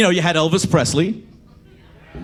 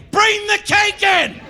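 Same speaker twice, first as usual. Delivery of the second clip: mock exasperated yell; slower than usual. Yelling at song character.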